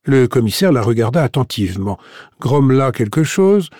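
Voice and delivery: usual speaking voice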